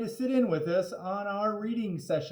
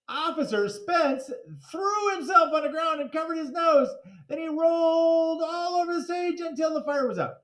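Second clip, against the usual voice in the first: excited; 11 semitones higher than usual. Acted. Excited narration of stunt.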